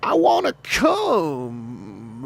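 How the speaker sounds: high, slow, hammed-up southern foghorn drawl; Overlord foghorn voice. Shown as a demonstration. Voice actor demoing his Overlord character voice, hammed-up southern foghorn drawl. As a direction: do a big Foghorn Leghorn southern boom. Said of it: "when I do the Overlord voice".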